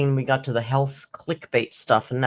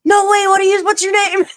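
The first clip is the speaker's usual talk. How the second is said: much higher, much louder starstruck squeal; shrieking fan; 10 dB louder than usual. Retold or imitated. Teller realising she's famous, squealing with excitement. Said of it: "He went".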